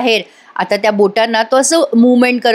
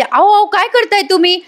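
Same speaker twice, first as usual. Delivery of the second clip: imitating Maina crying out in alarm, pitched up; alarmed mock cry, much higher; retold shout; 9 semitones higher than usual. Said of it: "मैना मोठ्याने ओरडते".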